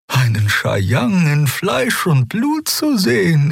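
high, reverent and slow; awed young healer. A narrator's reading. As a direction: speak as if meeting a legend. Xea speaks in awe.

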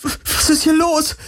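very high, stammered panic; high-pitched, cracking alarm. A narrator's reading. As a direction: stammer it out, voice flipping up. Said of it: "Kirais Stimme überschlug sich".

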